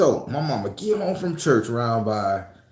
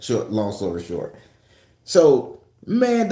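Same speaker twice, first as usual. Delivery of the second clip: usual speaking voice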